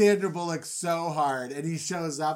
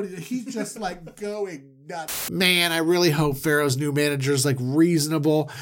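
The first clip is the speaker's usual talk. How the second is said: ordinary talk, nothing marked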